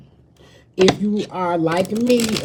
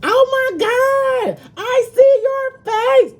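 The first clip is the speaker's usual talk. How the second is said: squealed very high and slow, mock-shrieking with delight; mocking a squealing fan; 6 dB louder, slower than usual. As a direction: mimic a gushing fan who squeals out every word. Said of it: "was like".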